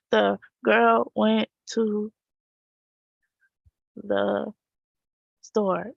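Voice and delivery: slow, choppy word-by-word reading; halting reading. Shown as a demonstration. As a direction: stall on each word. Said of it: "reading like a robot".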